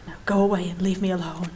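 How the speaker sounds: breathy whisper, low and soft, no voice at all; whispered dismissal. Acted. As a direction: hush it out, pushing them away. Jumper sends rescuer away.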